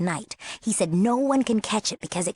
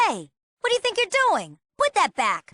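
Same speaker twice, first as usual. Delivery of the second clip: high-pitched, indignant challenge; outraged telling-off; indignant scolding; 11 semitones higher than usual. Acted. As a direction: catch someone in the act, voice leaping up. Catches someone meddling with things.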